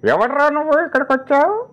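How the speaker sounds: very high put-on film voice; Dasavatharam character voice. Dubbed film character challenging an intruder, high-pitched put-on voice. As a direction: jump far up in pitch and hold it there. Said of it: "లేక బామ్మగారి క్యారెక్టర్కి చెప్పినా".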